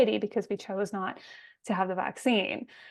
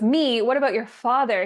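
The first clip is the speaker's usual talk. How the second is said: mock stepmom outburst; 2 semitones higher, 9 dB louder than usual. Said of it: "She goes".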